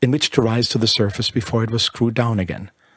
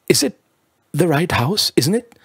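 high anxious pleading, much higher; 7 semitones higher than usual. A narrator's reading. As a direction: plead nervously, voice jumping up. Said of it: "he pleaded".

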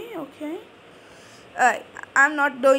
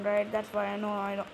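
ordinary talk

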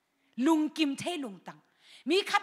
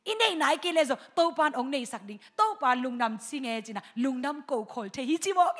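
ordinary talk, nothing marked

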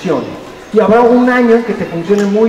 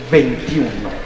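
ordinary talk, nothing marked

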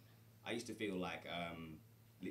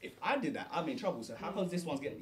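put-on higher, louder child complaining it's unfair; child protesting unfair treatment, higher and louder; imitating child; 9 semitones higher, 9 dB louder than usual. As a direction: play the indignant kid. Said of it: "he's like".

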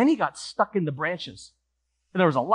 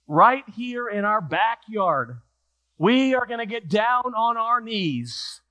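very slow, measured mother's voice; slow, deliberate instruction; 3 semitones higher, slower than usual. Acted. Mother tells Johnny they will pray, slow and deliberate. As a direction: lay it out slowly, phrase by phrase.

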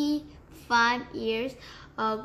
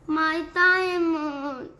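screamed; 5 semitones higher, 5 dB louder, slower than usual. A narrator's reading. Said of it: "screamed the Duchess".

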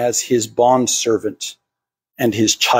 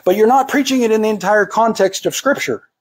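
mock objection; 8 semitones higher than usual. Imagined objector interrupts preacher.

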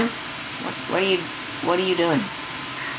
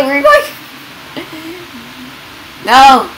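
normal talking voice

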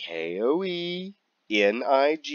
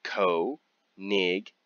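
normal talking voice

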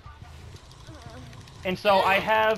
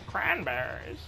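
lower, soft odd voice; imitating Aaron; 5 semitones lower, 7 dB quieter, slower than usual. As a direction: drone the word oddly. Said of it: "he just kept going".

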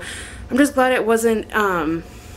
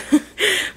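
short laugh; 10 semitones higher than usual. Real, not acted. Laughing at herself.

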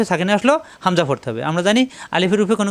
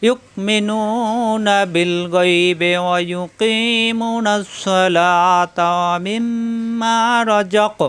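slow, drawn-out chanted recitation; Quran recitation; much slower than usual. Acted. Teacher recites Sura Baqara example, slow chanted tajweed. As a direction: intone it slowly like tajweed recitation.

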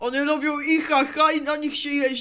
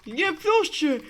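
mock pained protest; 3 semitones higher, 4 dB louder than usual. Protesting pain, cries.

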